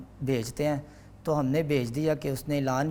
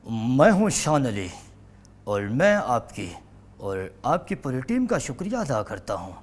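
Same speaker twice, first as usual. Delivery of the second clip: mentor's voice. Acted. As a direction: boom it out like a stage host. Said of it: "آپ نے جن سے سیکھا تھا ذرا ان کی آواز میں". (استاد کی آواز میں بلند، پُرجوش شکریہ)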